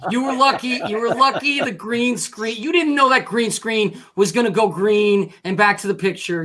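ordinary delivery

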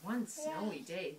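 plain reading voice

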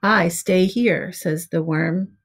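ordinary delivery

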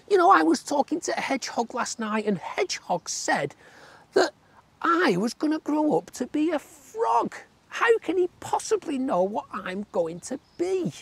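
squeaky tadpole, aghast at frogs. A narrator's reading. Tadpole talking to friends.

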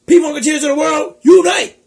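rally the crowd at full voice; rallying call. Acted. Calling the viewers to unite, loud rally cry.

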